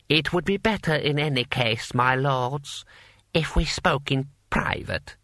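measured formal address; lightly voiced lord confiding in his peers; smooth scheming lord. A narrator's reading. Lord Hong addresses the warlords.

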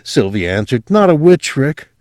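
lightly voiced young woman, high and earnest; confessing her strange family with rising fervour; a hint of a girl gone dreamy. Sylvia voiced in story.

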